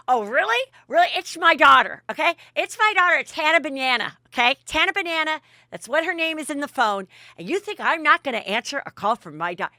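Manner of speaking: loud, high mock outrage; hammed-up offended retort; angry defensiveness, imitated. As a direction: act out a mother indignantly snapping back. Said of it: "is still angry as can be".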